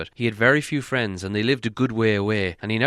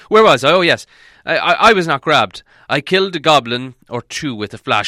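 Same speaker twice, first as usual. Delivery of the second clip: higher, louder Gandalf voice; eager old wizard; 5 semitones higher, 9 dB louder than usual. Acted. Gandalf telling Beorn his tale, higher and louder. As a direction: hurry on with the tale, a bit flustered.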